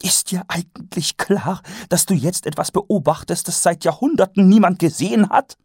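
enthuse to the captain; excited wonder. A narrator's reading. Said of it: "in ihren Augen glühte Aufregung".

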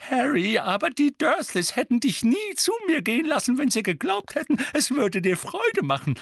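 high, loud, wailing Mrs. figure; tragic old woman. A narrator's reading. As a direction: lament dramatically in a high-pitched voice. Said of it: "sagte sie mit tragischer Miene".

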